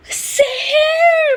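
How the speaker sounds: a high, drawn-out mock call of the name; imitated shout. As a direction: stretch the name out high and loud, like calling after her. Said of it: "फिर ऐसे करके भागी है".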